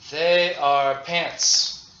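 slow model sentence. Shown as a demonstration. Teacher modelling the sentence slowly for the class. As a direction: lay each word out slowly. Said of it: "Now let's make a sentence".

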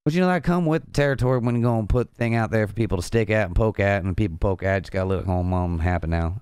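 put-on quick, rambling Southern accent; hammed-up fast Southern twang. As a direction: rattle it off in a country Southern accent. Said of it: "Gonna make me do the southern accent".

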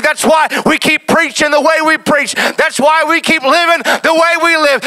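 loud, high, driving sermon climax; full-force preaching. Acted. Pastor rallying the congregation, full-force preaching. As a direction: preach it out over the organ.

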